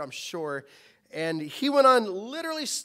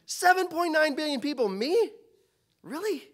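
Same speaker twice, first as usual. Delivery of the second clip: incredulous surprise; 8 semitones higher than usual. Retold or imitated. Listener amazed God chose them.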